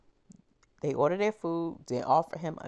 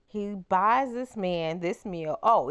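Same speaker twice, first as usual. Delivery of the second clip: ordinary talk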